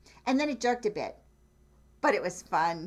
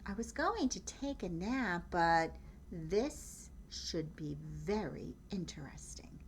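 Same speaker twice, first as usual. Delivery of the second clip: soft, fading to a slow whisper; Cat's sly thought; 6 dB quieter than usual. Acted. Cat thinking about her lost nap, soft slow whisper. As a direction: murmur it lazily, drifting into a whisper. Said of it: "what she thought".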